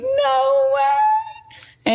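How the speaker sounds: high, squealed no way; screaming no way. Retold or imitated. Falling to the floor over the test, high squeal of disbelief. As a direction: squeal it like you can't believe it. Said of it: "screaming and being like".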